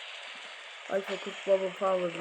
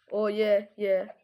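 ordinary talk, nothing marked

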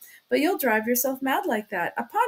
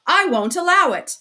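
high, very loud furious shout; 7 semitones higher, 9 dB louder than usual. A narrator's reading. Suspect defying the investigator again, shouting. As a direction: yell it out at full force. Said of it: "Raskolnikov shouted again".

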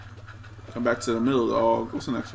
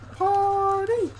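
squeaky high voice; 13 semitones higher than usual. Pretend. High squeaky party chant.

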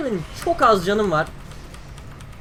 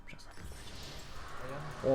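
whispered to himself; 5 semitones lower, 18 dB quieter than usual. Real, not acted. Whispers item choice.

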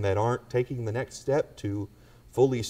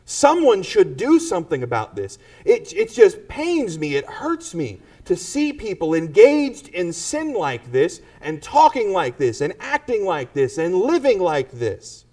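pained believer; 6 semitones higher, 6 dB louder than usual. Retold or imitated. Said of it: "Maybe you know".

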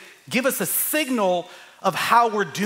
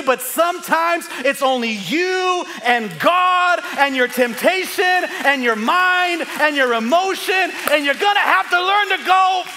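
high, loud, building to a cry; preacher peaking at the congregation, high and loud; preaching climax; 7 semitones higher, 5 dB louder than usual. Acted. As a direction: swell to a shout. Sermon climax, self-talk quote.

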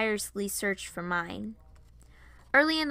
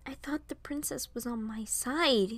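higher, halting, hesitant; regretful apology; 5 semitones higher than usual. A narrator's reading. Varian apologizes to Piper for yelling, regretful. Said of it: "in regret".

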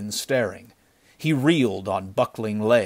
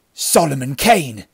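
alarmed outburst, louder and higher; gasped recognition; 4 semitones higher, 7 dB louder than usual. A narrator's reading. Bandit hearing of the slayer, gasping in alarm. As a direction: exclaim the dreaded name. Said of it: "gasped the bandit".

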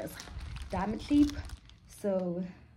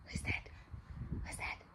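whispering; 10 dB quieter than usual. Real, not acted. Whispering to baby.

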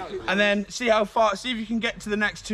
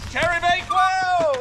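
gleeful shout; 9 semitones higher than usual. Real, not acted. Celebratory catchphrase shout.

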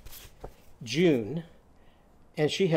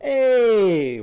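loud high Hey!; shouted hey; 6 semitones higher, 8 dB louder than usual. Shown as a demonstration. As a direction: call it out like flying downhill. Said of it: "What's our sound for Abe".

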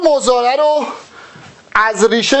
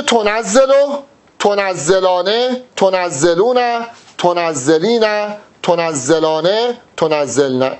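low, slow, drawn-out chant of each verb form; teacher chanting the past-tense table for students; slow paradigm recitation; 4 semitones lower, much slower than usual. Shown as a demonstration. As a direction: recite the conjugation one form at a time.

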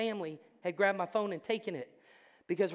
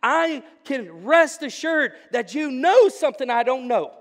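preaching at full force; 3 semitones higher, 8 dB louder than usual. Real, not acted. Preacher rouses the congregation on surrender, loud and fervent. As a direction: pour it out to the congregation. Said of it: "I'm starting to preach".

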